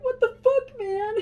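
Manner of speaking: high, loud, hammed-up outburst; mock outraged grandpa. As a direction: explode in disbelief at the damage. Grandpa reacts to dead trees.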